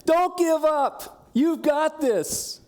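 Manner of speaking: high, loud cheering shout, slow and stretched; crowd yelling. Retold or imitated. The crowd in the stands urges a tired runner to keep going, high loud drawn-out yelling. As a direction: yell like a stadium crowd urging a runner on. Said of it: "And they're yelling".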